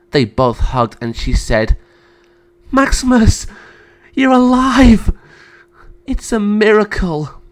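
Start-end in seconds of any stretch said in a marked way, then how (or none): 2.7-7.4 high-pitched, amazed joy; overjoyed. Acted. Freed sister seeing her brother alive, overjoyed. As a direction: cry out in happy disbelief. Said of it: "was so happy to see her brother".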